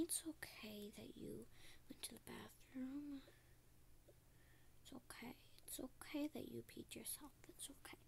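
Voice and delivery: soft hushed reassurance; whispered comfort. Acted. Teacher whispers to the girl who wet herself, soothing. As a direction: whisper gently to calm her down.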